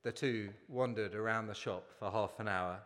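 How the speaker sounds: normal talking voice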